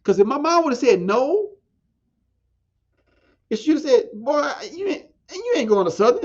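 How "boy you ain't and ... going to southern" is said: higher mock mother scolding her son; stern mom. Mom refusing her son college, lightly mocked scolding. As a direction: do your mom telling you off, pitch up. Voicing his mom's imagined refusal.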